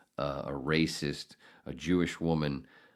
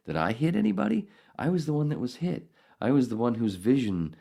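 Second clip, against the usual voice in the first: indignant questions; 6 semitones higher than usual. Real, not acted.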